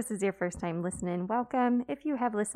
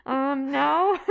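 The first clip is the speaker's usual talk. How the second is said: sheepish answer; 5 semitones higher, 8 dB louder than usual. Retold or imitated. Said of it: "I'm like".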